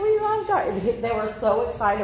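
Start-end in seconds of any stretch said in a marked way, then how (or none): 0.0-1.0 higher, gushing voiced pets; voicing the dogs. Owner voicing her other dogs greeting Tonka, high and gushing. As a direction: squeal it like excited pups. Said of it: "They're like".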